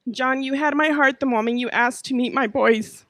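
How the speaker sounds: ordinary talk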